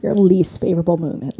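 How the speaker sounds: usual speaking voice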